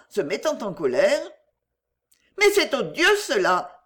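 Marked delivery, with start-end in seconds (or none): 2.4-3.8 higher and louder indignant cry; violent outburst. A narrator's reading. Geneviève raging against the forced marriage, violently indignant. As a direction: spit out the outrage. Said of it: "dit-elle violemment".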